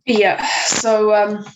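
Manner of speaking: talking through hiccups; hiccuping between words. Real, not acted. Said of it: "I've got the hiccups".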